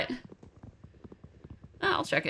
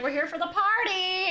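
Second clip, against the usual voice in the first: chipper neighbor voice; 7 semitones higher than usual. Retold or imitated. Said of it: "they're like".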